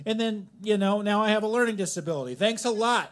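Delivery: high, insistent comic rant; worked-up rant. Real, not acted. Worked-up comic rant about fish.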